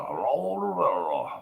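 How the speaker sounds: loud, high, drawn-out mock European hello; continental European accent. Streamer shows off his attitude, hammed-up continental European accent. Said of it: "continental European accent or whatever".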